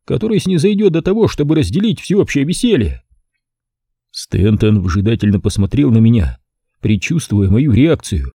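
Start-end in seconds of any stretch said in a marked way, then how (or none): 0.0-3.0 higher, livelier host voice; lightly voiced cheerful rich host; Stanton's voice. A narrator's reading. Host Stanton to his guest, lightly voiced.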